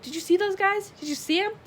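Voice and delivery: high-pitched, loud, excited questions; giddy after a narrow escape; thrilled. A narrator's reading. Beverly to her friends after escaping, thrilled. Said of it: "Her eyes sparkled".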